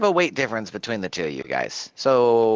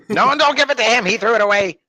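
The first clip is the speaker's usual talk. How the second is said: loud, high-pitched objection; urgent protest; 8 semitones higher, 6 dB louder, faster than usual. Pretend. Player warning Ernst not to hand over booze, urgent protest. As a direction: protest loudly over the others.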